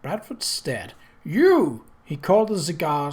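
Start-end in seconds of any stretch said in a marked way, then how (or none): 1.2-1.8 sharp startled cry, louder; astonished. Acted. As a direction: blurt it out in disbelief. Bradford stares, astonished.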